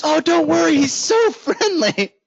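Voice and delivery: high, slow, sing-song reassurance; a lightly mocking cheery agent, drawn out; breezy agent voice. Listing agent brushing off his worry about the dog, high and slow. She goes.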